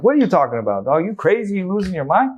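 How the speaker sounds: high, loud, heated confrontation; in his face. Retold or imitated. Patient confronts the doctor to his face, angry and high. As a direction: get in his face and challenge him. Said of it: "I got in his face".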